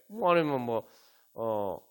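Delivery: ordinary talk, nothing marked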